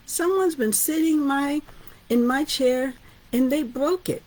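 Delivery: high, whiny little bear voice; Baby Bear. Acted. Teacher voicing Baby Bear finding empty bowl, high and whiny. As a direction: complain like a small upset cub.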